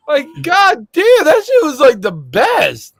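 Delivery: high, drawn-out rave; raving. Real, not acted. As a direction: savour every word of praise. Raving about Pepsi Twist.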